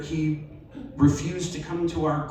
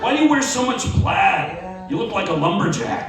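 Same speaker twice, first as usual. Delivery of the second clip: sharp, louder teasing jab, rising higher on the plaid line; irritated mocking brother; 5 semitones higher, 5 dB louder than usual. Brother mocking his sibling's college style, irritated and scornful. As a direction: needle the sibling about his music and clothes. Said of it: "which obviously irritated him".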